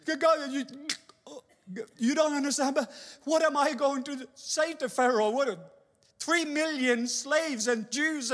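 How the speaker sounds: a frightened man begging God; anxious Moses. Acted.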